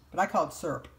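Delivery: normal talking voice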